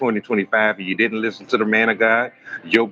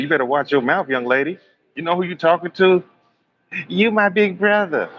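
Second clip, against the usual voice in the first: mock scolding; 6 semitones higher than usual. Scolding quarrel with the girl.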